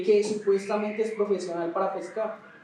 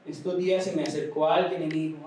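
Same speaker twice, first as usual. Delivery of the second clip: usual speaking voice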